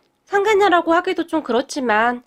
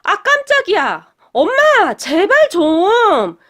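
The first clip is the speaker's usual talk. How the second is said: higher and louder yelp of surprise, then a slow plea; startled; 7 semitones higher, 6 dB louder, much slower than usual. A narrator's reading. Younger brother startled by his mother slamming the table, yelps. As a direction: jumps at the noise, then begs slowly. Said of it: "깜짝 놀란 도련님이".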